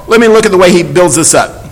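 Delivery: ordinary talk, nothing marked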